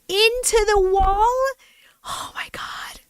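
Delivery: high gasp then whispered; stunned, fading to a whisper; shocked disbelief. Real, not acted. Shocked reaction to the story.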